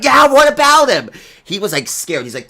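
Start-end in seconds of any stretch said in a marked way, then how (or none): 0.0-1.1 re-enacted crash-out yell, high and very loud; fuming scream. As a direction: explode in a high, furious shout. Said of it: "I was genuinely fuming".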